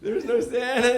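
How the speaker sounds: lightly voiced parent breaking bad news; mock parental admission; parents confessing. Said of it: "And they're like".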